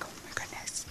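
barely voiced, whispered exclamation; hushed retold reaction, almost all breath; whispered aside. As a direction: whisper it under your breath. Retold awed reaction under her breath.

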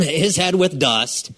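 ordinary delivery

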